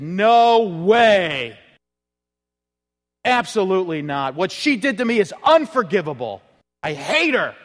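loud, bitter no-way; angry refusal. Retold or imitated. A bitter husband refusing to forgive, angry. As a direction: spit out the refusal he can't take back.

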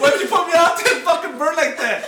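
playful furious shout through laughter; laughing outrage. Real, not acted. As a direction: yell at your friend while cracking up. Mock outrage at friend, laughing.

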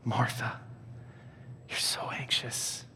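hushed, slow, tender reproof; gentle whisper. Acted. Jesus speaking to anxious Martha, gently whispering. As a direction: whisper it softly to soothe.

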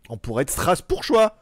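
normal talking voice

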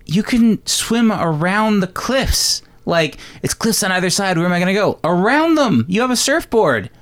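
high, heated rant about the ending; angry rant. Real, not acted. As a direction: vent your annoyance, pitch way up. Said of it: "I was so angry".

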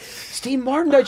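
high, drawn-out mock tearful wail; retold tearful. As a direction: stretch it out high, re-enacting being choked up. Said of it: "I'm tearing up".

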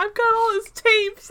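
high, drawn-out fan voice; imitated gushing fan. Quoting an eager fan in retelling.

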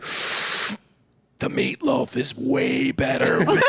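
slow whispered conspiratorial line; stoned whisper. Retold or imitated. Stoner brother tempting his sibling through the window, whispered. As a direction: whisper it slowly like a secret. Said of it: "he'd be like".

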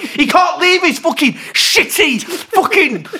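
high, heated tirade; furious rant. Real, not acted. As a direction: tear into him, voice climbing. Ranting about the dating vlogger.

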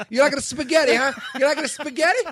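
loud, high cartoon Italian; mock Italian accent. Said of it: "don't make him do impressions of Italian people".

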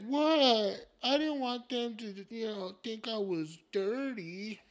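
high, drawn-out mock-innocent voice; slow, high-pitched whine of self-defence; indignant grandma. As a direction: imitate a fussy mom protesting.

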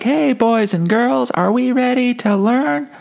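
sweet kindergarten teacher greeting the class; cheery teacher. Retold or imitated. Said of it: "this teacher's like".

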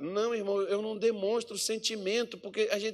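full-force preaching. Acted.

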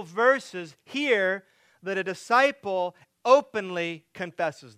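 loud, high, building sermon climax; preaching at full force. Acted. Pastor to congregation, preaching at full force. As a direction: preach it out with rising fervor to the congregation.

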